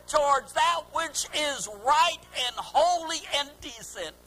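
forceful sermon with punched key words; preaching. Acted. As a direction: preach in weighty, separated phrases.

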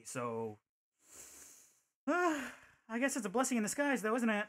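usual speaking voice

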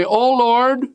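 high, gushing churchgoer voice; mock pious worshipper. Preacher mocking a deceived worshipper, high and gushing. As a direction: gush like a fooled believer. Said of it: "and say".

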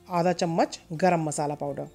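ordinary delivery

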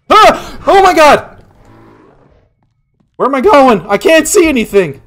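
high frantic shouts; panicked screaming. Real, not acted. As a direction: scream while fleeing. Running from monster.

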